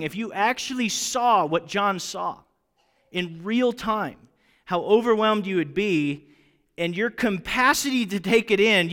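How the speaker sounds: ordinary talk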